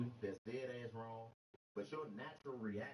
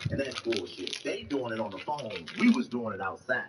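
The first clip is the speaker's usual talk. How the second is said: loud rant; 2 semitones lower, 14 dB louder than usual. Real, not acted. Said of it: "ranting at full force".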